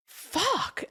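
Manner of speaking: high-pitched drawn-out curse; retold dismay. Telling friend of opening the bill, retold dismay. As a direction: let the swear soar up in mock horror. Retelling reaction to getting the bill.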